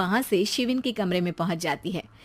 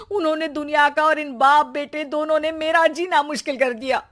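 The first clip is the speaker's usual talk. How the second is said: lamenting wife; 5 semitones higher than usual. Acted. Mother laments to God.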